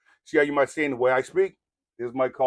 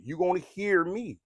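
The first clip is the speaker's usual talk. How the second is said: usual speaking voice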